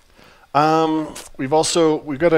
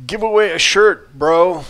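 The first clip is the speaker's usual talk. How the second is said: higher, louder bro-style demand; pushy bro; 6 semitones higher, 7 dB louder, slower than usual. Retold or imitated. Co-host prompts the T-shirt giveaway, mock-pushy bro. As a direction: call it out like an insistent bro. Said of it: "It was like".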